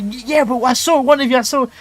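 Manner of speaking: high, loud mimicked insistence; insisting teacher. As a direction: argue back as the teacher.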